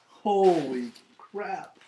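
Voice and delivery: awed surprise. Real, not acted. Unpacking the crew's box, slow, high, awed surprise. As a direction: let it stretch out in slow wonder. Finding more gifts in box.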